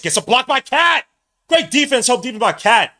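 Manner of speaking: loud, high-pitched burst of commentary; excited play-by-play shout. Real, not acted. Excited call of live game play.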